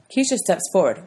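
ordinary delivery, nothing marked

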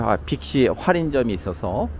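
normal talking voice

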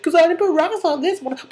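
high, loud, rapid comeback; Sebastian. Acted. Sebastian revealing his slushy, high loud hammed-up retort. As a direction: blurt it out high and fast, play-acting. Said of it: "He's like".

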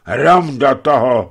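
loud, slow cursing shout; loud curse. Acted. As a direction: shout it as the beer spills. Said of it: "vykřikl hlasitě".

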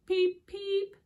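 high, loud chirp; baby bird peep. A narrator's reading. Storyteller chirping as the first baby bird. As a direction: chirp like a tiny chick. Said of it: "said baby bird number two".

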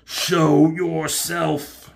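Unicron voice. Retold or imitated. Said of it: "He says".

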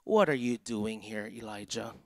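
low-pitched, solemn question, softening at the end; voice of God. Retold or imitated. God speaks to Elijah in his cave, low and solemn. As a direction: ask it deep and measured, as God to Elijah. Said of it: "there came a voice to him".

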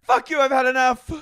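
high, loud hammed-up outburst; mock freak out. Cohost echoing the imagined meltdown, loud mock yell. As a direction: yell like a melting-down star.

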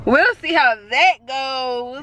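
doubtful drawn-out. Shown as a demonstration. Repeats phrase, second time drawn out.

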